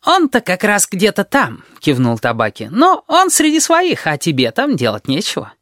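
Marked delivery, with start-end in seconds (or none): 0.1-1.6 high, slow character voice; chattering Tabaki. A narrator's reading. Tabaki answers where Lord is, high and slow. 2.7-5.5 very high start, dropping to normal; discouraging Tabaki. A narrator's reading. Tabaki discourages his friend from following, high then falling.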